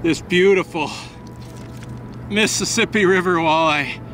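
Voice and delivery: breathless, thrilled angler showing off a prize; out of breath, excited. Real, not acted. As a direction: talk through heavy breath after the big catch. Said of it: "I'm a little out of breath, both from excitement".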